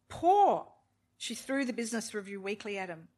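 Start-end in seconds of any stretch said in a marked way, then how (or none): 0.1-0.7 much higher, louder repeat; anguished repeat. A narrator's reading. Wife repeating Poor in anguish, higher. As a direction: wail the word Poor. Echo with more force.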